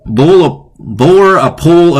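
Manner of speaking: slow, drawn-out bore a pole; trying out a spoonerism slowly. Shown as a demonstration. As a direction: sound out bore a pole carefully. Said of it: "these are becoming tongue twisters".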